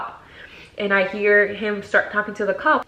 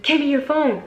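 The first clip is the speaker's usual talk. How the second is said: higher-pitched, forceful imitated order; insistent demand; 6 semitones higher than usual. Man demanding the phone after she refused, insistent.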